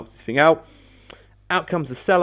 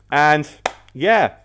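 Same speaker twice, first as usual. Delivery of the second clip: usual speaking voice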